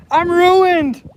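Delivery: high, loud playful cry; excited yelp. Real, not acted. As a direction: yelp it out in mock despair. Mock dismay while fishing alone.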